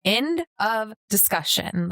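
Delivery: slow, deliberate parental verdict; measured, no-argument ruling; firm finality. Retold or imitated. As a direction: shut down the debate, word by word. Quotes own final word to child.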